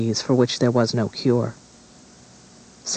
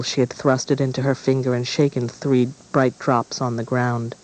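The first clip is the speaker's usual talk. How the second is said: ordinary delivery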